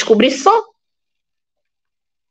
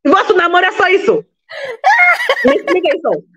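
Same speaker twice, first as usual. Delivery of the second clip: laughing; 9 semitones higher than usual. Real, not acted. Laughing at the couples.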